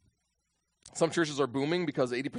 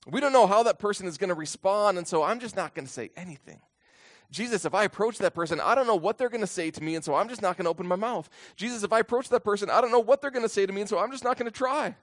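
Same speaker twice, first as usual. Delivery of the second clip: lightly acted fretful excuses, pitched higher; mock excuse-making; 7 semitones higher, 4 dB louder than usual. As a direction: reel off excuses in a raised, insistent voice. Acting out disciples making excuses to Jesus.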